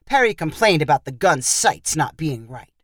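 ordinary delivery

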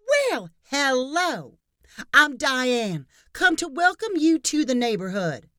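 high, slow, loud sugary greeting; loud gushing neighbor. Acted. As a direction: gush a loud welcome at the door. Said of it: "the woman said loudly".

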